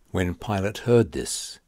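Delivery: usual speaking voice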